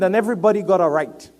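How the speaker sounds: loud, high, quick declaration; emphatic preaching. Real, not acted. Preacher declaring everybody's right, loud and emphatic. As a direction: proclaim it firmly to the room. Preaching, then repeats it hushed.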